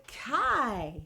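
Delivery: ordinary delivery, nothing marked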